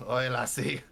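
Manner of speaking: light Scots brogue; gruff lassie voice. Acted. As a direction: cheer her on like an old dwarf. Dwarf-like character praising.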